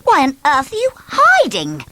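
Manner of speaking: high, loud, impatient; bossy demand. Acted. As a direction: demand it, hands on hips. Bossy interrogates Miss Shy.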